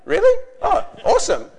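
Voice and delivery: high, loud, then brisk cheering on; acted relief, urging them on loudly; surprised encouragement. Preacher reassured by the children's answer, surprised and encouraging.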